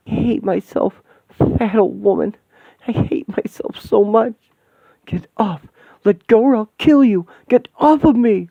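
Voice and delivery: high, loud, desperate cries; frantic self-loathing. Acted. Possessed woman fighting the demon, frantic and high.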